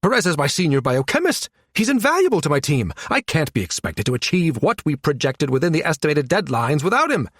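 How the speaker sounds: high, agitated objection; indignant protest. Acted. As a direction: protest in alarm, pitch jumping up. Flustered scientist protesting loss of colleague.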